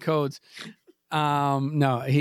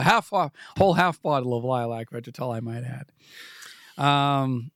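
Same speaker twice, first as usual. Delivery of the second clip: normal talking voice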